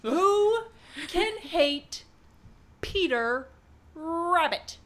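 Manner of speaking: high, loud, outraged. Real, not acted. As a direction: spell out each word in indignation. Outraged about Peter Rabbit.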